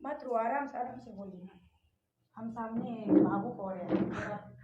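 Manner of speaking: soft, hushed reassurance; calm her down gently; soothing. Real, not acted. Advocate softly calming the tearful woman beside her.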